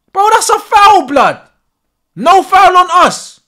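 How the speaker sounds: loud, high-pitched protest; outraged shout. Real, not acted. Fan disputing the foul call, outraged shout. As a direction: shout it in real indignation.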